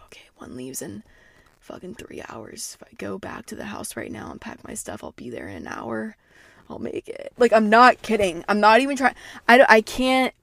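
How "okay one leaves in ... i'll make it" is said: very quiet, half-whispered planning; hushed, private self-talk; muttering to self. Retold or imitated. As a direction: murmur it under the breath, working out an escape. Acting out secretly planning her escape.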